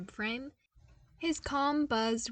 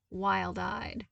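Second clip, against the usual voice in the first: usual speaking voice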